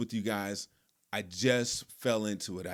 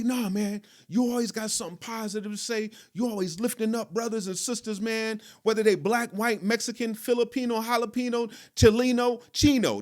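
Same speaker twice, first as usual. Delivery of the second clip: high-pitched imitation of a smooth-talking friend; Terrence; 9 semitones higher than usual. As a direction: reel off the list high, punching the rhymes. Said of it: "That's how he would talk, just like that".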